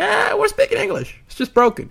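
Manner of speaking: very high-pitched mocking protest; mock Pidgin speaker. Nigerian host imitates people defending their broken English, playful high mock protest. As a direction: put on a squeaky, playful protest. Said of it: "we always make fun of".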